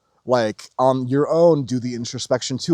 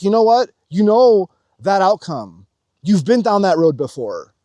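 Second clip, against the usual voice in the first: higher, louder urgent warning; Trinity presses him to stay, raised and urgent; Trinity, firm and urgent; 6 semitones higher, 6 dB louder than usual. Retold or imitated. Trinity stops Neo, urging him, raised voice. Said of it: "and she's like".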